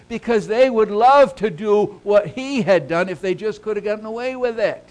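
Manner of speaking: loud, high preacher piling up the words; impassioned, emphatic sermon delivery; forceful preaching. Real, not acted. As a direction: hammer out each noun from the pulpit.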